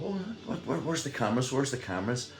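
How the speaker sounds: put-on high, startled kid voice, then settling; gasping like a nervous kid; sudden high alarm fading into repeated questions; mock kids' alarm. Kids asking where cameras are.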